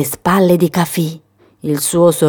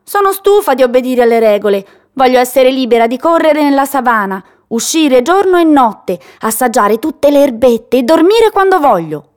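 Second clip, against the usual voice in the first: higher, defiant little-goat voice; a bratty kid demanding her freedom; haughty young goat, higher and pushy; presumptuous; 7 semitones higher than usual. Acted. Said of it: "con fare presuntuoso".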